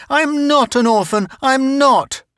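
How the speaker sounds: put-on child shrieking back at his tormentor; screaming and crying. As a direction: scream it through tears. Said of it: "yelling, screaming, crying".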